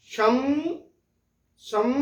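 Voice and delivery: शम and सम closing on a humming म with the lips shut; सम said with the mouth closing on a nasal म; anusvara demo. Shown as a demonstration. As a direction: hum the final म of सम through the nose. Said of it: "अब देखिए ये जो अनुस्वार का जो मौ".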